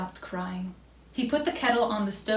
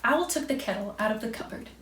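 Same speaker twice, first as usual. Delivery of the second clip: usual speaking voice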